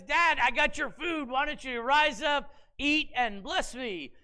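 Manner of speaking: lightly voiced hunter calling to his father; eager Esau. Esau bringing food to his father, lightly voiced and eager.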